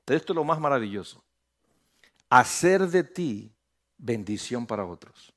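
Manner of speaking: ordinary delivery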